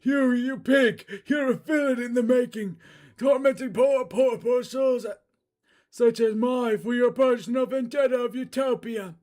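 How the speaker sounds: put-on high, loud outraged old man; high, loud indignant cry; homeless man's voice. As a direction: cry out at the villains in anguish. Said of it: "this is the homeless guy".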